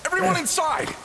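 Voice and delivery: high, urgent calls to take cover; yelling at everyone to get inside fast; alarmed shouts. Acted. Hero yelling to his crew to get inside.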